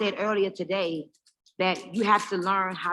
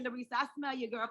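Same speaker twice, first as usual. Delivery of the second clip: ordinary delivery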